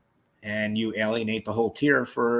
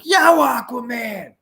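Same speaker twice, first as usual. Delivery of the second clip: high, loud, stretched call; mock shout; 12 semitones higher, 9 dB louder, slower than usual. Calling up to the soaked inmate on the tier, loud drawn-out shout. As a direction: holler the nickname slowly up the tier.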